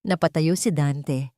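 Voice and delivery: usual speaking voice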